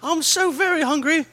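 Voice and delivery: high, loud starving orphan; put-on piping urchin voice; Dickensian waif. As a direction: plead like a hammed-up hungry waif. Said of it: "turned to a Dickensian waif".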